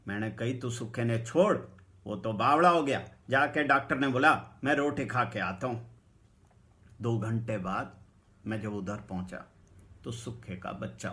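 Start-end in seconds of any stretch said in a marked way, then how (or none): 0.6-6.0 loud, brusque instruction; loud order. Retold or imitated. Narrator loudly telling the potter to fetch a doctor. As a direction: tell him off loudly.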